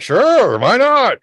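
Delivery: high, carefree sure why not; mock reckless cheer; careless bravado. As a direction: shrug it off happily. Drunk bravado retold.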